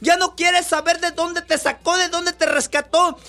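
predicador a voz en cuello, con fervor; predicación enérgica. Acted. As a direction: exhorta a la congregación con fuerza. Preaching to congregation. (predicando con pasión a la congregación)

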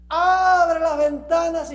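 loud, high burst of preaching; emphatic proclamation. Acted. Preacher telling of Daniel praying openly, emphatic proclamation. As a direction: call it out boldly, stretching the first word.

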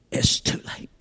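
"It's too late" breathed in a whisper. Acted. Repeated verdict, whispered.

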